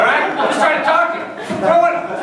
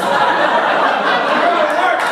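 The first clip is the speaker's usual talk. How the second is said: drawn-out scolding; 2 semitones higher, much slower than usual. Acted.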